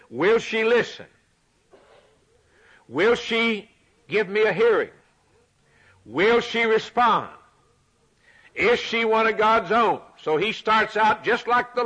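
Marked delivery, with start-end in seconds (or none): 0.1-10.0 rising fervor. Acted. Preacher voicing prophet's questions.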